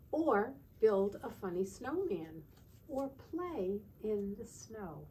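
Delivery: slow, storybook read-aloud; reading to children. Acted. Teacher reading a winter book to the class, storybook voice. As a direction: read it like a bedtime picture book.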